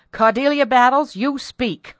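high, loud, imperious order; peremptory command. A narrator's reading. Mistress shaking the frightened maid, peremptory and loud. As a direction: order her to talk, loudly and sharply. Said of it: "who could be peremptory".

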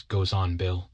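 ordinary delivery

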